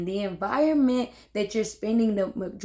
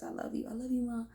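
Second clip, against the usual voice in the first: hushed aside; 3 semitones higher, 12 dB quieter, faster than usual. Real, not acted. Aside to her mother, dropped voice.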